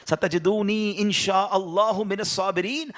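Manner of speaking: slow melodic Arabic recitation; Quranic recitation. Acted. Preacher quoting Abraham's words from the Quran, slow recitation. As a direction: draw out each word as scripture.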